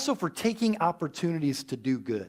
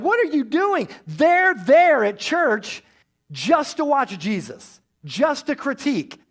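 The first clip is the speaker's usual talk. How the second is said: indignant scolding; 7 semitones higher, 5 dB louder, slower than usual. Real, not acted. Preacher scolds the church watchers.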